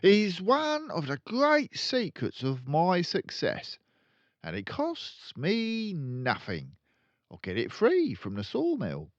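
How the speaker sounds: high, boastful dealer voice; proud father. Acted. Father bragging about his trade secret, boastful. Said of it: "he would say proudly".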